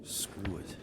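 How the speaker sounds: breathy whispered grumble. Real, not acted. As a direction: whisper it under your breath. Muttered alone in bed.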